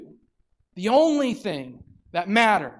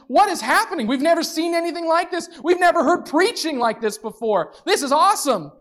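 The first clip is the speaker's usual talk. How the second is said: amazed crowd; 10 semitones higher, 4 dB louder than usual. Retold or imitated. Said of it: "praise God and going".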